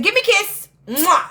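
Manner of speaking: squeaky, loud cartoon demand for a kiss; high mousy voice, playful and loud; Candy. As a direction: imitate a high Mickey Mouse voice, smacking a kiss. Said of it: "she has this real Mickey Mouse voice".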